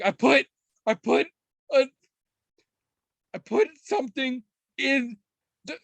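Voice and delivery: put-on high, breathless little-kid voice; giggly toddler. Toddler trying to confess a prank, high giggly voice. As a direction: squeak it out like a giggling three-year-old.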